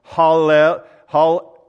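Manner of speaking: Hallel uitgesproken in losse lettergrepen, luid; word broken down. Shown as a demonstration. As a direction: say "Hallel" loudly and deliberately, syllable by syllable. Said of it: "I'm gonna get this".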